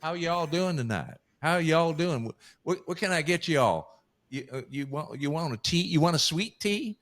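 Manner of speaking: mock Southern server greeting; Southern waitress. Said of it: "everybody says".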